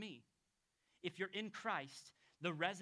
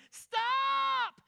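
sharp, much higher shout; car shouting stop; 14 dB louder than usual. Retold or imitated. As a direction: yell it like an alarm. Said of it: "it'd say".

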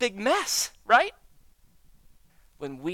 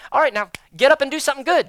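louder, higher, fast mock order; brisk mock command; 9 semitones higher, 11 dB louder, faster than usual. An imagined God ordering the broken believer, a brisk loud mock command. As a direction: bark it like a drill sergeant. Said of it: "God's not gonna suddenly say".

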